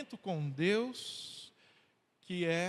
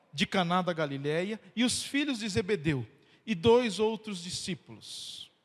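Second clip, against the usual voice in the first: raised, solemn Bible reading voice; scripture reading; 3 semitones higher, 5 dB louder than usual. Acted. Pastor reading scripture to the congregation, solemn. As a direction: read the passage out to the standing church. Reads John 21 aloud.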